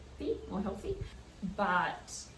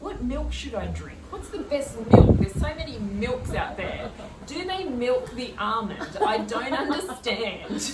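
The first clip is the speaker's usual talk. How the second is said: lightly voiced confused questions; confused self; 5 semitones higher, 9 dB louder than usual. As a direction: play your past self, baffled. Acts out her own confused questions.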